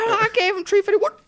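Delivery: high, sheepish put-on voice with laughter; cartoon wife. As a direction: confess in a high mock-wife voice. Voices wife in South Park bit.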